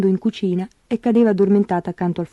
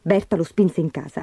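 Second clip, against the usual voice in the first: usual speaking voice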